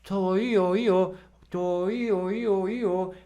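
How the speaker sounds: pouted legato "Tó, i, ó", tongue barely moving; small tongue movement. Shown as a demonstration. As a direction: glide "Tó, i, ó" with rounded lips. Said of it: "Olha como o movimento da língua é pequeno".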